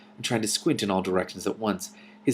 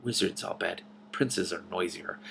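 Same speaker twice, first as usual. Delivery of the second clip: softer, slow muttering; 8 dB quieter than usual. A narrator's reading. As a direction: mutter under your breath. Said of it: "she muttered".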